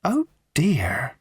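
breathy whisper in the high raffle-lady voice; a hushed, dawning realisation; the raffle lady whispering in sudden concern; whispered oh dear. Acted. Raffle woman, sudden thought.

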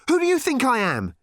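high, furious challenge; angry demand. A narrator's reading. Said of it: "she demanded angrily".

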